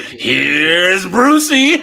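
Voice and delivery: long drawn-out, rising, loud announcement; loud, slow mock-horror entrance; hammed-up movie catchphrase. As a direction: stretch it out big and loud like a film villain. Parody of a famous film line.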